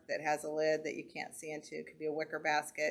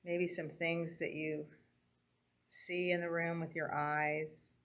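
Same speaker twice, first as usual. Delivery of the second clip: calm grounding exercise. Acted. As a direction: guide the breathing gently with pauses. Leads a breathing and senses exercise.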